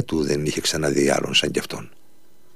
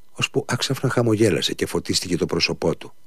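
plain reading voice

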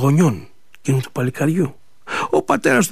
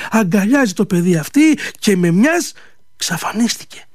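usual speaking voice

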